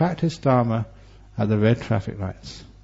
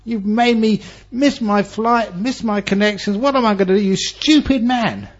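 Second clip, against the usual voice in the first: angry shout; 9 semitones higher than usual. Retold or imitated. Businessman yelling at the taxi driver, furious. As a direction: rage at the taxi driver over the missed flight. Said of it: "He was so angry".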